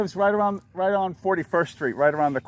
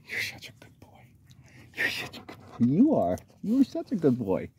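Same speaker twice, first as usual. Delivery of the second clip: talking to a dog. Real, not acted. Praising dog softly.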